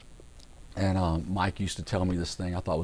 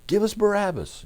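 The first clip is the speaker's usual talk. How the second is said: high-pitched imitation of a yelling mob; mock crowd yell; 13 semitones higher, 4 dB louder than usual. Preacher imitating the crowd before Pilate, high mock yell. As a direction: chant it high, like a crowd demanding. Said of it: "they start yelling".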